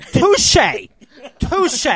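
high, loud exclaimed concession; big, high-pitched comic exclamation; mock-triumphant touché. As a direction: crow it like a punchline. Exclaimed comeback to a joke.

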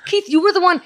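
incredulous outburst. Retold or imitated. Retelling telling off Keith for dumping the wax, high disbelief. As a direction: cry out at Keith in shock. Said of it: "And I'm like".

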